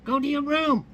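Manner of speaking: high, loud squawking parrot order. Acted. Parrot ordering the child away, loud high squawk. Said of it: "and the parrot says".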